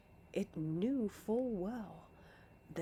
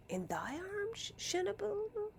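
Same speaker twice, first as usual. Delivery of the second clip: breathless exclaim; 11 semitones higher than usual. Acted. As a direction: gasp the question out in dread. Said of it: "I exclaimed breathlessly".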